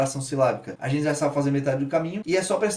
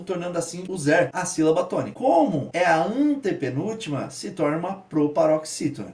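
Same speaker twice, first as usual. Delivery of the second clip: ordinary delivery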